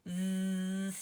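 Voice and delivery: Z buzzed out long with voice on; voiced Z. Shown as a demonstration. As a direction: stretch the Z and let it buzz. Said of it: "Do you hear the difference?".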